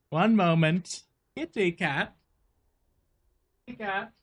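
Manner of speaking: soft, high coaxing voice; cat talk. Real, not acted. Player greeting the cat in the room, cooing softly. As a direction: coo gently up high to a pet.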